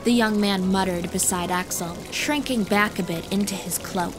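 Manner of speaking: plain reading voice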